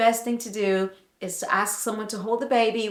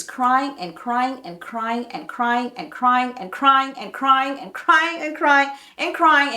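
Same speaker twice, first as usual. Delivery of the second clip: "crying" hammered over and over, building louder and higher; relentless repetition; 3 semitones higher, 4 dB louder than usual. Retold or imitated. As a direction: pile up each "crying" with growing exasperation. Acts out endless baby crying.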